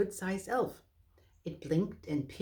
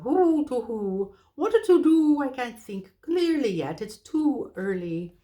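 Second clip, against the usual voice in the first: owl muttering; 6 semitones higher, 7 dB louder than usual. Acted. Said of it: "muttering to itself".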